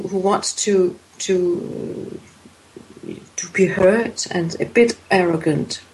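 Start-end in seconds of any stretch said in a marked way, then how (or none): none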